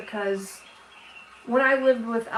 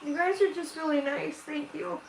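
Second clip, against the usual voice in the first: holding back tears; 5 semitones higher, 3 dB quieter than usual. Real, not acted. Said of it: "Sorry, I'm trying not to cry".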